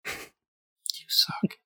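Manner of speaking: whispered comeback; whispering. Real, not acted. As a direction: hiss it back in a whisper. Whispered insult trade.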